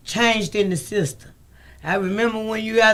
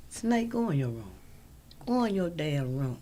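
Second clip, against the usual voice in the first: hushed aside; 4 semitones lower, 12 dB quieter than usual. Real, not acted. Telling someone off-mic to leave.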